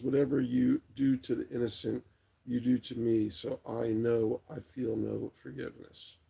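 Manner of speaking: normal talking voice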